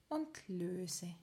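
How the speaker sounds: soft unvoiced whisper. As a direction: breathe the words out. Relaxation cue, whispered release.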